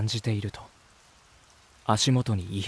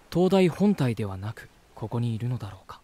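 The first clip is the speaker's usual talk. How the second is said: ordinary delivery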